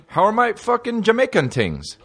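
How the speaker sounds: high-pitched mock Jamaican greeting; silly put-on Jamaican accent; playful Jamaican accent. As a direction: toss it out in a joking Jamaican lilt. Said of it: "talks, like, with a Jamaican accent".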